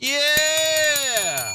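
high, loud excited yeah; excited cheer. Real, not acted. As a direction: cheer the right answer. Host cheers correct answer.

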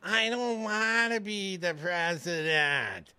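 slow, whining, put-on voice; Ron DeSantis whiny voice. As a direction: whine it like a sulky kid. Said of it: "it's sort of a whiny voice".

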